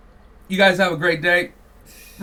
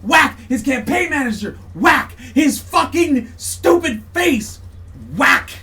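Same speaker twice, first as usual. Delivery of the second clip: higher, louder, each whack hit hard; louder and louder whacks in a rant; ranting shouts; 5 semitones higher, 4 dB louder, slower than usual. Real, not acted. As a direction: hammer every whack like a verdict.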